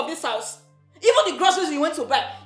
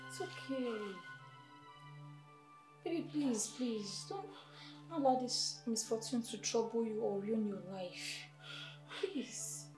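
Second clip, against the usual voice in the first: soft, low, gentle pleading; calm them down very quietly; soothing plea; 4 semitones lower, 14 dB quieter, slower than usual. Acted. Rose soothing the partner after the outburst, soft plea.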